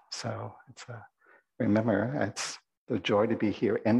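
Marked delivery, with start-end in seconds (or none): none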